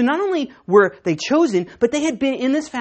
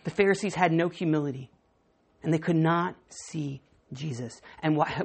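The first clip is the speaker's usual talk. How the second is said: usual speaking voice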